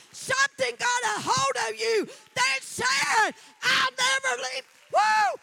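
climaxing sermon cry; preaching at full force. Real, not acted. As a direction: lift the church with it.

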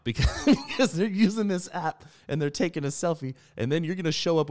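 high, loud, through laughter; amused, laughter lifting the voice; laughing while talking. Real, not acted. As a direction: crack up as you explain. Amused at people faking dating photos.

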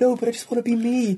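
high-pitched plaintive wail; mock protest. Performer boxed in by characters, mock high-pitched protest.

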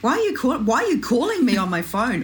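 high-pitched mock disbelief, repeating the complaint; exaggerated teen protest; indignant teen. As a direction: imitate an affronted teenage daughter. Acts out daughter objecting to call.

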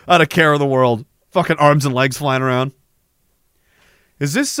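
ordinary talk, nothing marked

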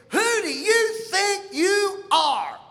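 mock-outraged mom; drawn-out indignant retort; indignant mother. Offended mother confronts him.

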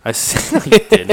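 breaks into high laughter mid-line; laughing. Real, not acted. Laughing at the ticket story.

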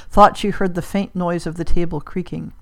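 ordinary delivery

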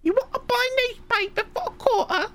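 put-on very high squeaky child voice; little orphan newsboy. Orphan child selling newspapers to a passer-by, high pleading squeak. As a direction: pipe up like a mock Cockney street urchin. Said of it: "some little orphan child on the street".

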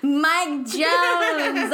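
laughing shout. Shown as a demonstration. Answering her friend's "Who?", a laughing shout. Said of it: "start over".

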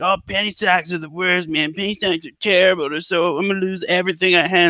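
mock complainer. Host imitating people who complain about penny stocks, mock complaining. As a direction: imitate someone griping about losses.

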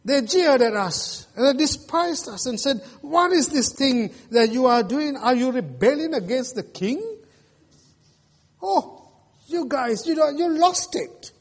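lightly voiced scoffers ridiculing the builders; a hint of a jeering crowd; mocking enemies.

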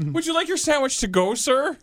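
high sweet fake-polite waiter; polite server voice. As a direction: play an overly polite clerk. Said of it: "mock server".

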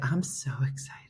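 voicing the dog in an excited hush; excited whisper. Owner voicing her excited dog in a whisper. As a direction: breathe it out, giddy and hushed. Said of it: "She was like".

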